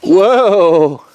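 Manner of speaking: loud, delighted cooing. Real, not acted. Baby enjoying the swing.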